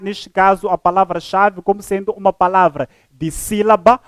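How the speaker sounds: ordinary delivery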